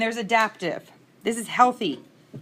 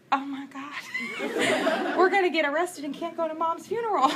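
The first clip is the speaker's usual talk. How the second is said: higher, laughing through an alarmed oh my God; laughing mock panic; 8 semitones higher than usual. Watching her sister lunge at the driver, laughing panic retold. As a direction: retell the panic while cracking up.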